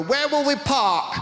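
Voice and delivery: slow, high, fretful Brit; a slow, worried resident asking about parking; mock British fusspot. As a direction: act out a dithering local. Typical British people voiced.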